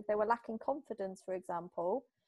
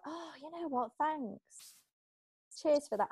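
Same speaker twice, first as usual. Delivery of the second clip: higher-pitched, lightly acted thanks; accepting a compliment; 6 semitones higher than usual. Someone told their top looks lovely, lightly acted grateful acceptance. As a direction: play a pleased little thank-you.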